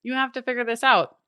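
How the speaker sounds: loud, high alarm-bell voice; alarmed brain. Retold or imitated. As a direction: sound the panic alarm. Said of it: "it's like".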